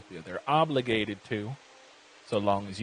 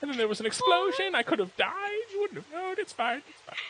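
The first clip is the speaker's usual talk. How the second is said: hurt cook voice. Acted. Hurt cook NPC guilt-trips.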